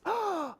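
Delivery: high startled gasp; sharp gasp. Acted. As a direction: gasp in surprise. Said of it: "And then they gasp".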